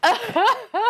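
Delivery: gasp, then a high shriek; real shocked, very high outburst; stunned disbelief. Real, not acted. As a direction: gasp and break off, then squeal it out. Shock at pulling the secret.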